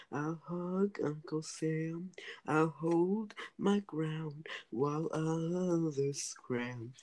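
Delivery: slow, solemn poem reading; poem recitation. Acted. Recites war poem slowly.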